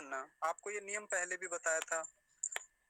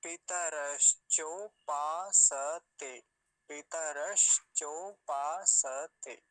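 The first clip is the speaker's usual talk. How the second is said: very slow, loud, drawn-out syllables; slowed right down; 7 dB louder, much slower than usual. Shown as a demonstration. Teacher slowing his speed for learners, very slow syllables. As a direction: stretch every syllable far apart. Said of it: "धीरे speed करता हूं".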